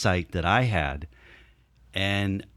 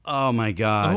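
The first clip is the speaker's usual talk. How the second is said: ordinary talk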